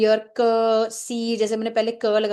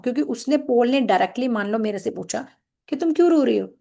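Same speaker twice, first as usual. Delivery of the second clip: ordinary delivery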